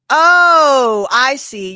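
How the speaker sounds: a loud high Oh, then I see; loud mock realisation, catching them out; sarcastic oh I see. Real, not acted. Sudden realisation at viewer's question.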